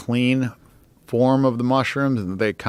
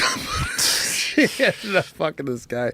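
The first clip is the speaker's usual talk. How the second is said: real laugh breaking into the words, voice jumping up high; amused, laughing through the confession; 10 semitones higher than usual. Real, not acted. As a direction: crack up while telling it. Laughs at own outrageous story.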